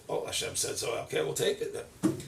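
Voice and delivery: soft, breathy compliance; meek acceptance. Acted. Child accepting the decree, hushed. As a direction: give in quietly.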